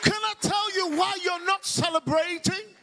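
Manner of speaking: high-pitched, driving preacher's proclamation; rally the congregation with rising fervour; full-force preaching. Real, not acted.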